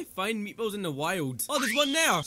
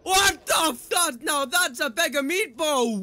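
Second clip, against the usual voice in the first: loud, higher outburst, cut off; startled exclamation; 5 semitones higher, 5 dB louder than usual. Real, not acted. Gamer spotting something huge, startled shout. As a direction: burst out in shock.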